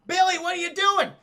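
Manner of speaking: imitating an alarmed shout; alarmed call. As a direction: call out to a friend who has lost it. Arnold calls out to Billy.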